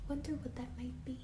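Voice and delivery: soft, breathy teasing whisper; suspense whisper. A narrator's reading. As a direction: make the kids lean in to guess.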